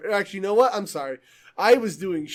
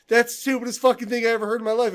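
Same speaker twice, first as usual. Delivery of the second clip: quick, higher mock exasperation; annoyed game master; 5 semitones higher, 4 dB louder, faster than usual. Player imitating the DM judging a dumb scheme, mock annoyance. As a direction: rattle it off like a fed-up game master. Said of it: "gets so fucking annoyed".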